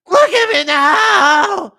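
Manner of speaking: high, slow screamed demand; screaming fighter. Retold or imitated. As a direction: scream it into the mic. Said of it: "Leon keeps screaming".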